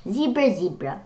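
usual speaking voice

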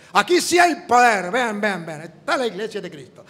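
imitating a preacher, loud shout that settles back; mock revival preacher crying out; mock pastor shout. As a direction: ham up the boasting pastor yelling to the crowd. Pastor preaching his power claim.

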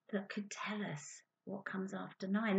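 soft whispered question; hushed whisper to the class. Real, not acted. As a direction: whisper it like a secret. Whispered aside to children.

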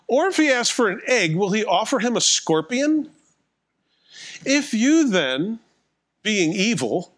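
preacher reading scripture aloud. Acted. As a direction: read the verses with weight.

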